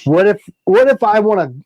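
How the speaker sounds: usual speaking voice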